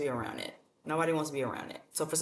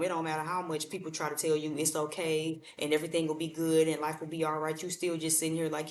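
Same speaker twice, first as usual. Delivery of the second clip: ordinary talk, nothing marked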